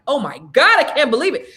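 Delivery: shocked. Retold or imitated. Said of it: "And I was like".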